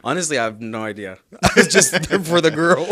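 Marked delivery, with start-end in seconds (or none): 1.3-2.9 loud, high laughing admission. Real, not acted. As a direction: admit it through a laugh. Laughing confession.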